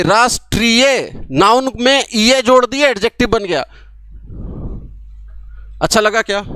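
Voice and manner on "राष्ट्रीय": राष्ट्रीय drawn out slowly, falling at the end; slow demo word. Shown as a demonstration. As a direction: spell out राष्ट्रीय slowly for students. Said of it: "तो कैसे बनाए थे?".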